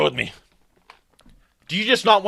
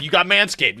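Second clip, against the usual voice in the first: ordinary delivery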